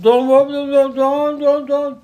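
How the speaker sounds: very high, loud repeated don't; urgent protest. Retold or imitated. Moshe stopping the people, high urgent shouting. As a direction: cry out to stop them.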